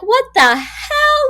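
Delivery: high, loud and slow disbelieving cry; shocked outburst. Retold or imitated. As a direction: blurt it out in disbelief. Host retells reacting to the book.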